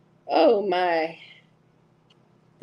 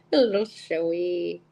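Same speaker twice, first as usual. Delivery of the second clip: usual speaking voice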